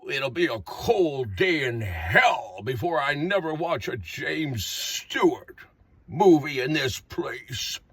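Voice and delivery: put-on low, slow old-man grumble. Cranky old resident refusing a movie, low old-man grumble. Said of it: "he says to the nursing home attendee".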